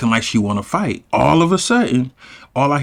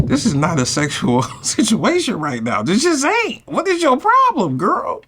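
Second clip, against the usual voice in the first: shocked squeal; 9 semitones higher than usual. Retold or imitated. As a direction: voice shooting way higher, half laughing. He recalls snapping at Megan.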